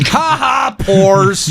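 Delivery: high, taunting ha-ha; mocking jeer. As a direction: crow over the poor with playful scorn. Mocking apartment owners as poor.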